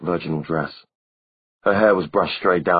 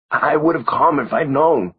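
slow, cracking plea; 9 semitones higher, 5 dB louder than usual. A narrator's reading. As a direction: voice breaking with remorse. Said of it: "Simon's voice cracked".

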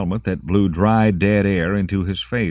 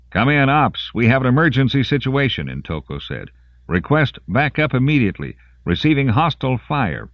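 higher, pressing report for backup; urgent radio call; 8 semitones higher, 3 dB louder than usual. Acted. Soldier radios Ops for backup, urgent and clipped. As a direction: call it in fast and tight.

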